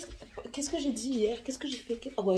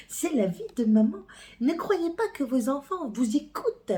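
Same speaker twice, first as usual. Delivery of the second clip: ordinary delivery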